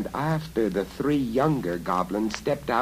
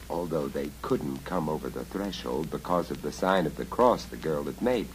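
usual speaking voice

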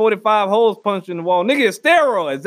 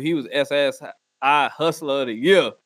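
ordinary delivery

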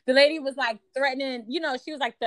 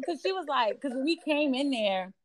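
usual speaking voice

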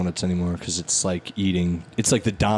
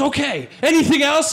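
donut lady voice. Retold or imitated. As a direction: bark the order like a brusque shopkeeper. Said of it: "And she goes".

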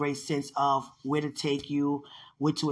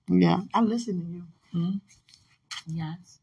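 ordinary talk, nothing marked

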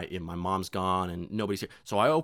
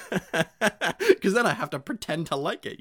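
laughing; 8 semitones higher, 7 dB louder, slower than usual. Real, not acted.

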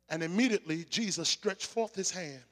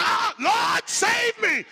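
high, drawn-out preacher's shout; acted, full-voiced plea from the depths; desperate cry for help; 10 dB louder, much slower than usual. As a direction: cry out to the Lord as a drowning man. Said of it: "when he began to see".

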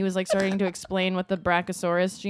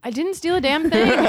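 high, loud protest; mock-indignant denial; 8 semitones higher, 6 dB louder than usual. Real, not acted. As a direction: insist on innocence, loud and high. Teased again about stealing, denies it.

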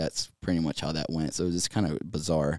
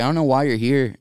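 much higher and louder, earnest disbelief; emphatic protest; 8 semitones higher, 10 dB louder than usual. Retold or imitated. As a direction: push back at the praise. Protesting to mentor Kevin.

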